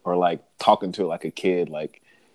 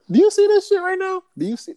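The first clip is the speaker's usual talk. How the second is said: much higher, louder mock lion outburst; lion talking to homeboy; 5 dB louder, faster than usual. Lion asks his homeboy about the intruder, high incredulous yell. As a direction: squeal it out in disbelief as the lion. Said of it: "and just looking, it's like".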